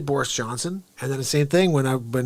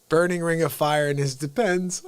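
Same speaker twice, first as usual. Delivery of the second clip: ordinary talk, nothing marked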